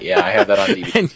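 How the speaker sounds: amused laughing aside. Real, not acted. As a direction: laugh while you answer. Laughing reply.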